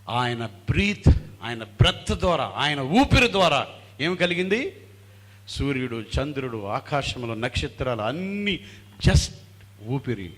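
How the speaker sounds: loud, insistent sermon build; ringing rhetorical preaching; emphatic preaching. Real, not acted. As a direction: drive each phrase home to the congregation.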